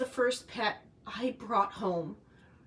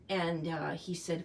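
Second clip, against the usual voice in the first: normal talking voice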